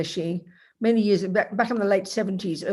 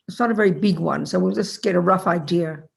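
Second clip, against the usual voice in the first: ordinary talk, nothing marked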